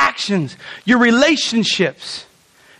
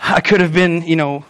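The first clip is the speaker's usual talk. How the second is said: usual speaking voice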